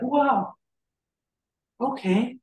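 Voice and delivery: high-pitched, wondering reaction; dawning surprise, lightly acted; retold amazement. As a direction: take it in with mild wonder. Acting out his amazed reaction.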